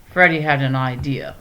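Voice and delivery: plain reading voice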